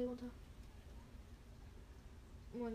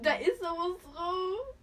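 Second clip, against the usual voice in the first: alarmed exclaim; 5 semitones higher, 9 dB louder, slower than usual. Real, not acted. Alarmed about lip.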